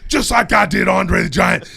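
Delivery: loud, shouting wrestling promo, brother; Hulk Hogan promo. Shown as a demonstration. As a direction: yell the promo like a hyped wrestler. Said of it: "Hulk Hogan promo held".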